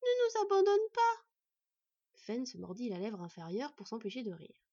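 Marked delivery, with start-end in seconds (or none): 0.0-1.2 put-on high, loud little-girl cry; small cub begging not to be left; desperate child's plea. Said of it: "persistèrent-elles en chœur".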